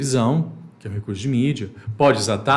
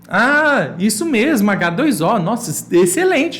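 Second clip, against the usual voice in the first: high, loud delighted praise; praising pupil; 8 semitones higher, 6 dB louder than usual. Shown as a demonstration. Teacher praising an imaginary pupil, excited. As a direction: cheer the right answer. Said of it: "Finge que a pessoa respondeu".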